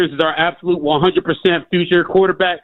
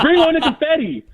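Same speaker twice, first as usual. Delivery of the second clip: excited cheer; 11 semitones higher than usual. Real, not acted. Caller cheering on request.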